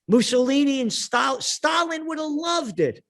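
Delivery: rising to a high shout on Stalin; retold outburst. Speaker yells at the lawyer about Stalin, a high rising shout. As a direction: blast the last words, reliving it.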